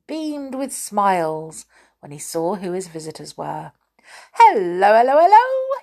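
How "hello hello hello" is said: loud, much higher, beaming hello; cheery Moonface greeting. Acted. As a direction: sing out a big happy welcome. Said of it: "he said".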